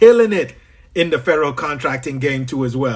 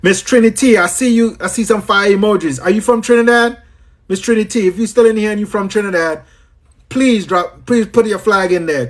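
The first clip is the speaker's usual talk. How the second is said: higher-pitched, eager call to a viewer; excited shout-out; 6 semitones higher than usual. Real, not acted. Host asking Miss Trinity if she's from Trinidad, excited. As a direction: hail someone who may be from home. Hails viewer maybe from his homeland.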